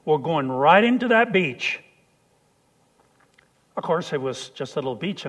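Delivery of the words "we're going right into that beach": loud, urgent shout to steer; screamed order. Retold or imitated. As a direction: yell the steering order over the storm. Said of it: "I screamed at him".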